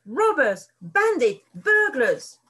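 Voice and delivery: loud furious yells, one per word; angry rat yelling. A narrator's reading. Rat yells insults at the fleeing foxes. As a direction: shout each insult after them. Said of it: "screeched Rat".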